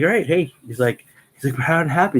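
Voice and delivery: hammed-up eager recruit; eager asking. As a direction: jump in keen for the verdict. Recruit asks how it went.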